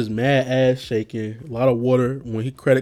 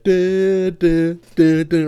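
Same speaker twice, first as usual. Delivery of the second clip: mock musical sting; 5 semitones higher, 6 dB louder, much slower than usual. Imitating dramatic musical sting.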